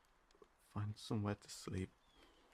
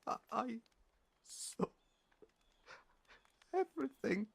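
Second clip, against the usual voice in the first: distressed; 3 dB louder than usual. Acted. Confessing to the stranger they saw them die, voice breaking high. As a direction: voice climbing as it nearly breaks. Confessing witnessing their death.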